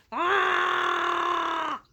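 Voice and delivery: raging troll roar; troll roaring with rage at the goat. Acted. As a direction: let out a furious troll roar.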